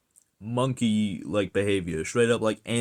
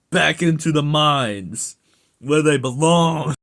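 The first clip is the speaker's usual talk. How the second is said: louder, higher, slowing on the mines; rising rant; 5 semitones higher, 7 dB louder than usual. Real, not acted. Ranting at OnlyFans models, building to a loud shout.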